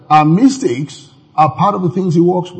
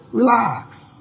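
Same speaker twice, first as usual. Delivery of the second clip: soft, hushed reassurance; gentle relax; 5 semitones higher, 9 dB quieter than usual. Retold or imitated. Jesus calms his frightened disciples, softer. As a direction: say it quietly to calm them. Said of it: "he just said".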